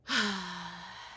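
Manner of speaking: soft, drawn-out sigh; heavy sigh. Real, not acted. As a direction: let the breath go. Pause before the moment of clarity.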